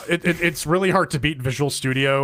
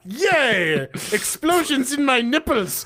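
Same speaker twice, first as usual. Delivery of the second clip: mock excitement; 10 semitones higher, much slower than usual. Mock excitement after exploding joke.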